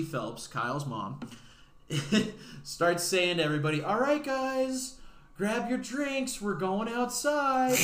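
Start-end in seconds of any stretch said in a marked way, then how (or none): 3.8-7.8 high, slow, cheery call to the group; rally everyone like a hostess; sing-song hostess call to grab drinks; calling the party. Retold or imitated. Mom calling everybody outside.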